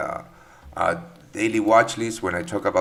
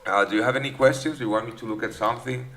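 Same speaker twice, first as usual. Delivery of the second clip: ordinary talk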